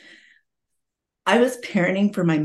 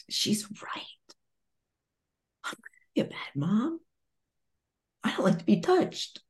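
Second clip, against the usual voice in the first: soft tearful whisper, then a little higher; crying; 4 semitones higher, 6 dB quieter than usual. Retold or imitated. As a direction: whisper through retold tears. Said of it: "I remember just crying and going".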